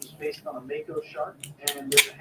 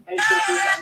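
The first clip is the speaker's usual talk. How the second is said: loud greeting; 13 semitones higher, 11 dB louder than usual. Acted. Louder repeat of greeting.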